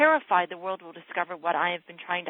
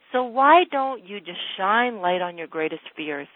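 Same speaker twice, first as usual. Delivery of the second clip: usual speaking voice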